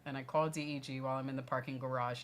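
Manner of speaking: normal talking voice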